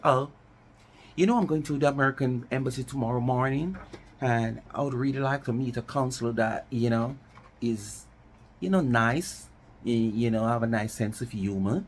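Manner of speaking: a lightly put-on American-style twang, brisk; mock the phony proper-English show-off. Mocking someone faking proper English, light twang. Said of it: "trying to twinge twang".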